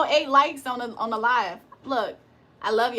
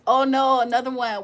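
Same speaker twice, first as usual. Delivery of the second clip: usual speaking voice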